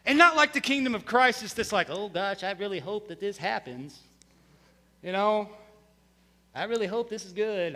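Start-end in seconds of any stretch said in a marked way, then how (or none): 1.9-7.7 soft, low, fretful worrier; hushed anxious hand-wringing; worried voice. Retold or imitated. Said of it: "I don't know why I'm doing that voice".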